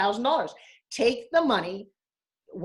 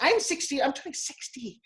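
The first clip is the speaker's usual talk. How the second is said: ordinary delivery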